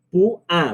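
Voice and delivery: PÚA split into pú-a; two syllables. Shown as a demonstration. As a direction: say Púa in two separate beats. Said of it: "En dos".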